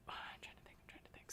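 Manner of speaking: breathy whisper while thinking aloud; barely voiced, murmured to herself. Real, not acted. Whispering to herself as she tries to remember.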